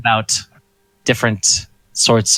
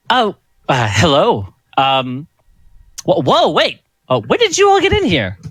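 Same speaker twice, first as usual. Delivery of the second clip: flustered, much higher pitch on the question; startled greeting; 6 semitones higher than usual. Acted. Wizard to visitors who just hugged him, startled. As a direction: act caught off guard by unexpected visitors.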